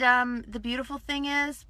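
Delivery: ordinary talk, nothing marked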